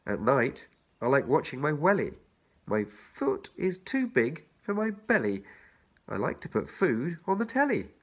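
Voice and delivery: put-on bouncy children's rhyme read. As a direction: lilt each line to its rhyme. Reads the rhyme aloud.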